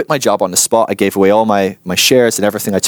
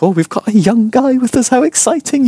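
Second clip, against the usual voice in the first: put-on high, gushing old-lady voice, lightly done; mock old ladies; 13 semitones higher than usual. Old ladies eyeing the young guy, high gushing coo. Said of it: "kinda looking over at me like".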